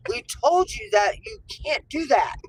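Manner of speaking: put-on boy telling off the dogs, loud and high; kid scolding dog.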